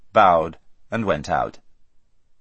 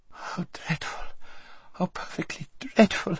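high, breathy frightened wail; a spoiled girl whimpering in fright; 9 semitones higher, 7 dB quieter than usual. A narrator's reading. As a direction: whimper in girlish panic. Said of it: "whimpered Lazareline".

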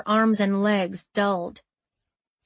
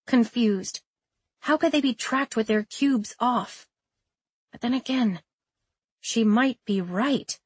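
ordinary delivery, nothing marked

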